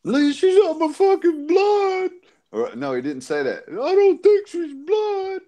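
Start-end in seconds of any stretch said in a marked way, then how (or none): 0.0-2.1 high-pitched, drawn-out wail imitation; mock crying. 3.7-5.4 high, drawn-out imitation wail; mock crying.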